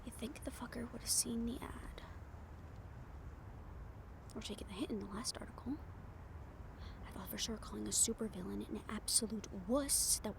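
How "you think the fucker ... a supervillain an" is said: hushed self-talk while waiting; whispering to herself. Acted. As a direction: whisper it so no one hears. Alone on rooftop talking to self.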